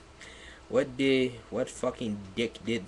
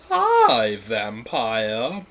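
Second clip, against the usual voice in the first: flirty; 7 dB louder, much slower than usual. Shown as a demonstration. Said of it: "I said flirty".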